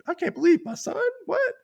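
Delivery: high-pitched mock disbelief; acting out a stunned father; shocked and impressed dad. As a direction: squeak it out in astonished disbelief. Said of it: "shocked, but also kind of impressed".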